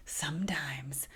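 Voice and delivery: hushed, drawn-out whisper; playful whisper. Pretend. Joking to viewers about becoming charismatic, slow whisper. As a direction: whisper it slowly. Playful aside after laughing.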